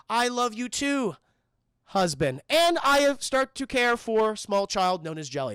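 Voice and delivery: slow, stiff, robotic declaration; stilted wife impression. Zooey answers her husband's love, slow stilted delivery. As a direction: recite affection woodenly, word by word.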